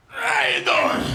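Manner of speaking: very high, loud strained-groan greeting; phony Soprano impression. Phony Jersey Sopranos greeting people, very high and straining. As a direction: grunt it like getting up off a couch. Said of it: "sound like they're just getting up from a seated position".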